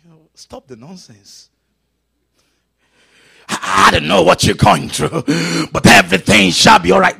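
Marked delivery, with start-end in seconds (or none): 3.5-7.2 loud, high whooping with gasps; mock whooping preacher. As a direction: parody a professional preacher whipping up the crowd. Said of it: "you can whoop without the voice".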